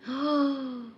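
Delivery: high, drawn-out gasp; sharp intake of breath in surprise; startled gasp. Real, not acted. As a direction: gasp at eerie news. Reacting to whisper comment.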